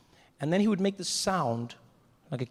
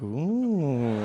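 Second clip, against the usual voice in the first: long drawn-out "ooh"; impressed ooh; 4 dB louder than usual. Retold or imitated. Spectator hearing the charmer's riddle, long impressed ooh. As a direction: draw out an intrigued ooh. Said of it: "And I'm like".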